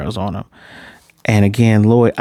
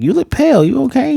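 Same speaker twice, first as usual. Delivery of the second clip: much higher, lightly put-on concerned coworker; coworker's voice. Coworker asking the pale husband, in a higher put-on voice. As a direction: squeak it up like a worried colleague. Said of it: "the other person before the trip was like".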